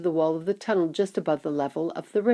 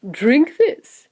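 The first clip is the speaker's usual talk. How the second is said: higher, louder urging; eager host; 8 semitones higher, 7 dB louder than usual. Acted. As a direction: offer it with bright insistence. Wonka urges a drink.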